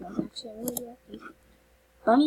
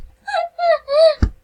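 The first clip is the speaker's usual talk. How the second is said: high, loud delighted squeal; imitated squeal; 10 dB louder than usual. Imitating her sister's reaction to the gifts, a loud high squeal. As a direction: squeal like your overjoyed little sister. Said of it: "'cause she'd be like".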